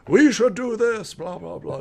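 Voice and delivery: high, loud mock preacher; preacher's voice. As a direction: proclaim it like a preacher to a crowd. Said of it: "preacher's voice".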